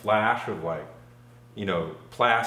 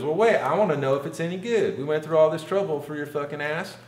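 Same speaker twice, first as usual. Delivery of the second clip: mock high woman's voice; 6 semitones higher, faster than usual. Woman demanding he test it.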